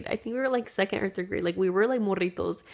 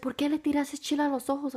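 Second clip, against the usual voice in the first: scolding mom; 6 semitones higher, faster than usual. Retold or imitated. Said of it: "she's like".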